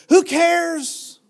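high, loud dismissive who cares with WHO hit hard; defiant shout. Acted. Preacher dismissing critics for his flock, defiant shout. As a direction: fling it off like nothing matters.

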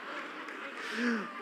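breathy chuckle at the clip; laughing along at the video; soft laugh. Real, not acted. Pastor laughing at his son's scare, soft chuckle. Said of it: "I was cracking up".

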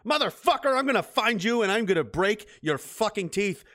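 high, loud furious yell; mad. Retold or imitated. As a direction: bellow it like someone just robbed you. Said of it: "You'd be mad".

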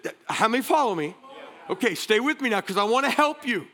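normal talking voice

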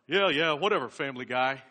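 loud, impatient mock hiss; hissed brush-off. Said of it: "he hissed".

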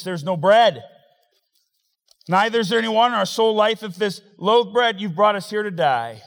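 loud, high, fast whining complaint; the people griping loudly in the wilderness; complaining Israelites. Acted. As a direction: hurl the grievance at Moses. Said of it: "look what he said".

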